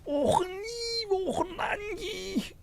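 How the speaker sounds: very high, drawn-out machine whine; squealing coffee-machine noise, stretched out; coffee machine imitation. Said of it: "distinct sound of a coffee machine".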